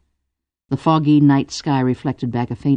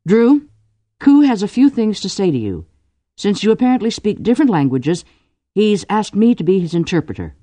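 higher-pitched, formal announcing voice; brisk go-between; 8 semitones higher, 3 dB louder than usual. A narrator's reading. Cordelia as interpreter tells Drew Ku has things to say, brisk.

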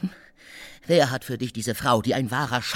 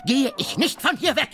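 shouting; 11 semitones higher than usual. Acted. Guest refusing to leave, shouting at Oblomov. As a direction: plant your feet and shout it. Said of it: "Warum schreien Sie so?".

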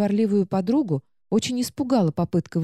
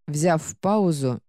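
plain reading voice

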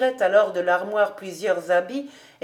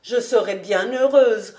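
higher, louder, exclaiming voice; fervent old housekeeper; 4 semitones higher, 6 dB louder than usual. Acted. Housekeeper wishing for a young mistress to relieve her, fervent. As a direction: plead heartily, stressing each repeated phrase.

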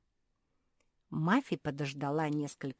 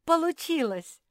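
high, loud cry of triumph; acted victory shout; jubilant; 13 semitones higher, 10 dB louder than usual. A narrator's reading. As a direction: exult that the effort worked. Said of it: "заликовала Мафи".

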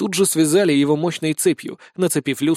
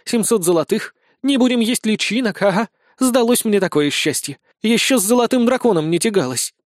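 higher-pitched sarcastic grumbling; disgruntled griping; 6 semitones higher than usual. A narrator's reading. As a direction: gripe indignantly, pitch raised. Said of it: "недовольно проворчала Безос". (недовольное ворчание)

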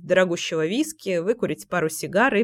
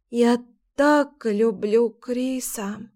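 slow slurring words, tongue barely moving; drunk, dragging each word out slowly; slurred drunk; 3 semitones higher, much slower than usual. A narrator's reading. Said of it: "еле ворочая языком".

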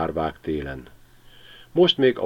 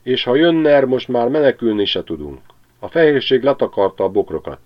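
aggódó veréb; 5 semitones higher, 5 dB louder than usual. A narrator's reading.

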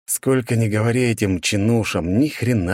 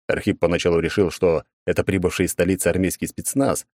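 ordinary talk

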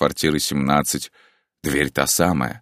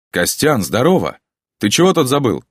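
higher and louder, cheerful friend; cheerful shout; 9 semitones higher, 5 dB louder than usual. A narrator's reading. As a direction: call out a bright, hearty greeting. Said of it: "бодрый голос". (бодрый голос Антона)

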